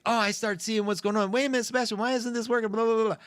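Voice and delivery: high-pitched mock-demanding fussing. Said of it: "I become really demanding".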